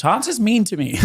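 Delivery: high-pitched tattletale imitation; hammed-up grievance; mock complaining. Acting out a friend's complaint.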